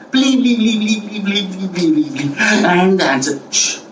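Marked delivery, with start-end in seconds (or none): 0.1-2.4 high-pitched mock pleading, rapid repeats; acted panicky begging without full volume; screaming hunter. Hunter attacked by the ants, mock screaming. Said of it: "hunter started screaming and said". 3.5-3.8 short sharp hush; hushing shh. Acted. Ants silence the screaming hunter, hushing.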